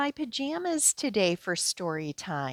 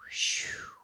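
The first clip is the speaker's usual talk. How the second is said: relieved sigh; 5 dB quieter than usual. Acted. Relief after dragon check.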